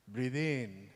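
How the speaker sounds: softly quiet breathe-in cue; calm child coaching. Retold or imitated. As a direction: gently lead the breath like a small coach. Son coaching his father.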